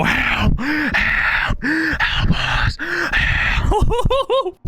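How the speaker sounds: high, drawn-out cry for help; yelling for help. Retold or imitated. Buddies yelling through a store as a prank, high drawn-out yell. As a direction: holler it out way high, stretching each cry. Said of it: "as loud as we can".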